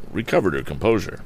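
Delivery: usual speaking voice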